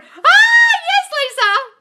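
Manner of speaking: squealing high, loud hello; excited shout. Real, not acted. Greeting a viewer who joins the live, excited shout. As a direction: shriek with delight at a familiar name.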